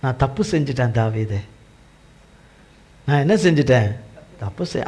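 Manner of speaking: a touch of tearful, hushed remorse; tearful confession. Acted. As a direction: let Saul plead softly, near tears. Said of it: "அப்போ அழுவுறாரு சவுலு".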